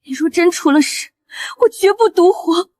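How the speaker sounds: high-pitched desperate vow; anguished vow. Acted. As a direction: swear it in distress, pitch lifted. Wife vows over wounded husband. (悲痛决绝地起誓)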